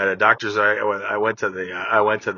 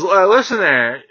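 doctor's voice; 5 semitones higher, 5 dB louder, slower than usual. Retold or imitated. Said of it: "he didn't say friend".

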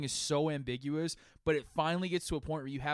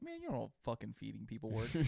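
low, soft and fast exasperated mutter; muttered under breath; 6 semitones lower, 10 dB quieter, faster than usual. Retold or imitated. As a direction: grumble it to yourself quickly. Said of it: "in my head, I've just been like".

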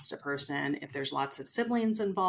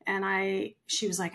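ordinary talk, nothing marked

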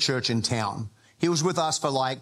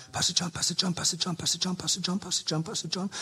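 lightly voiced, softer needy man repeating the name; a hint of a desperate caller calling for the pastor; pleading caller; 2 semitones higher, 5 dB quieter, faster than usual. Needy man on the phone begging his pastor, softly.

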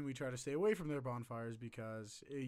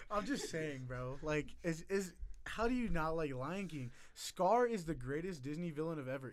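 rising, louder heated protest; 5 semitones higher, 6 dB louder than usual. Real, not acted. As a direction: get worked up defending Scar. Heated Lion King argument.

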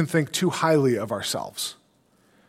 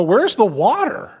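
higher, slow, complaining mock whine; whiny Samson; 6 semitones higher, 5 dB louder than usual. Preacher mocks Samson asking God for water, slow whine. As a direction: drag it out like a spoiled demand. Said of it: "I'm just gonna look around and go".

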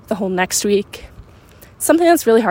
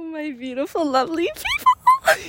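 gushing greeting; 6 semitones higher, 5 dB quieter than usual. Acted. Greeting listeners to open podcast.

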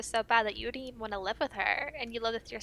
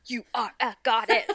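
laughing mock romance; 3 dB louder than usual. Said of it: "he's like".

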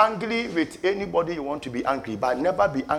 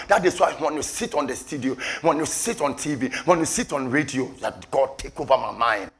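ordinary delivery

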